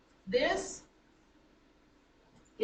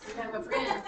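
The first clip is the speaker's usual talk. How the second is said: ordinary delivery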